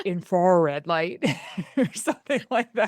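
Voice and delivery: "infrared" drawn out slowly in a Michigan accent. Shown as a demonstration. Host pokes fun at her own word, Michigan accent. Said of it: "more like my Michigan accent getting".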